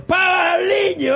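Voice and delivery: shouting. Acted. Mock outrage shout.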